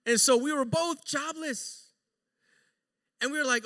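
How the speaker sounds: normal talking voice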